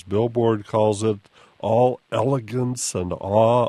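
ordinary talk, nothing marked

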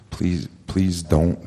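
slow, understated plea for mercy; terrified plea. Retold or imitated. Terrified man begging the storyteller in his face, slow plea. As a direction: imitate a frightened man begging, slowly. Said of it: "he's terrified".

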